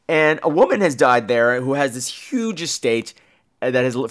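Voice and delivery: normal talking voice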